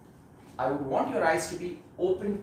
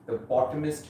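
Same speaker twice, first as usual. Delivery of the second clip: guided meditation; 4 dB quieter than usual. Acted. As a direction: ease them gently into stillness, unhurried. Leads guided meditation practice.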